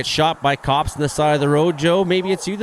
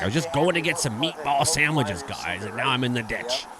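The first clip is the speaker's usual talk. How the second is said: mimicking Joe; 5 dB quieter than usual.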